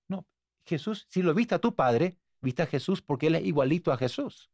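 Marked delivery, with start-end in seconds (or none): none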